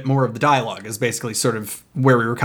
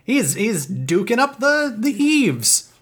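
put-on high, slow old Hollywood transatlantic voice; hammed-up glamorous film star; transatlantic accent; 8 semitones higher, much slower than usual. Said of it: "a transatlantic accent saying the words".

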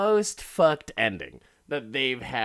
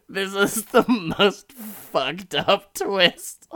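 appalled laughter; 6 semitones higher, 6 dB louder, slower than usual. Retold or imitated. Appalled reaction through laughter.

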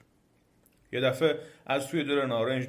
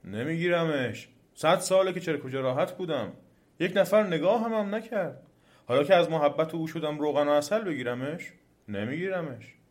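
higher-pitched, indignant complaint; aggrieved refusal; 5 semitones higher, 4 dB louder than usual. A narrator's reading. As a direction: refuse with an old grievance. Pool refuses the demon, aggrieved.

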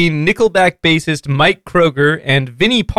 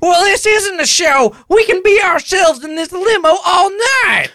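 put-on much higher dopey man-child voice; Ralph voice; 12 semitones higher than usual. [name] as Ralph, brushing off his dad in a high dopey voice. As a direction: whine it like a clueless slacker. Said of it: "my Ralph voice".

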